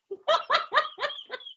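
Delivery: a sudden squeaky laugh; high burst of laughter. Real, not acted. Reader laughing at the caller's made-up words, high squeal.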